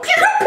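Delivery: very high, loud pew pew gunshot sounds; gunshot noises. Retold or imitated. Describing the snipers firing, loud high pew pew gunshots. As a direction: fire off cartoon laser shots.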